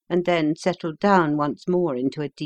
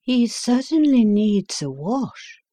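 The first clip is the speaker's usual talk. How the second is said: Holmes remarking; 6 semitones higher, 4 dB louder than usual. A narrator's reading. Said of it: "remarked Holmes".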